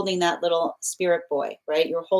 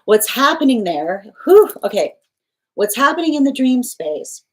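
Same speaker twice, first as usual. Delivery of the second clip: real emotion pushing the voice higher and louder; choked up; 6 semitones higher, 6 dB louder than usual. Real, not acted. Reader moved by the dream, choked up, voice rising. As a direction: fight back tears and push through. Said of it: "I'm getting choked up in this reading".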